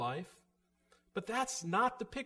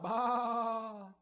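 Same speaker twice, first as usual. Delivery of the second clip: higher bleating cry of a hungry sheep; 5 semitones higher, much slower than usual. Acted. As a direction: bleat it out like a starving lamb. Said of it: "their voice cries out".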